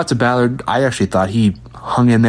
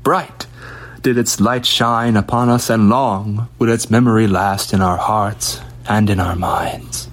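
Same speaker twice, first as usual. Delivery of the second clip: mock eulogy; 2 semitones higher than usual.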